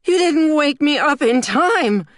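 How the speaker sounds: high, loud, drawn-out wail of outrage; screamed accusation. A narrator's reading. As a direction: shriek it at Dad in horror. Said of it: "and then screamed".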